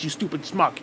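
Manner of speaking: ordinary talk, nothing marked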